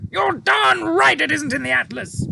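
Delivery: high, loud exasperated cry; cried. A narrator's reading. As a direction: Air Force chief crying out at the pilot, loud. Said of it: "cried the head".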